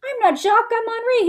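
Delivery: very high mock French soldier; pretending to be French. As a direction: answer back in a high, put-on Frenchman's voice. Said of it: "pretending like they're French".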